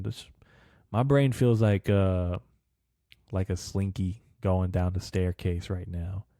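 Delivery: ordinary talk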